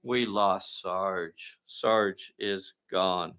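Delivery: slow, low, solemn lament; grave, drawn-out game-table grief; mock mourning. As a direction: announce a fallen comrade, softly and slowly. Game figure Sarge is lost.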